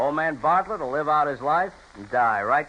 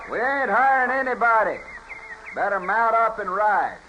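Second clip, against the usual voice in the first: raised, higher-pitched warning to clear off; a range boss running strangers off the ranch, hostile and raised; 7 semitones higher than usual. Acted. Range boss warns off the marshal.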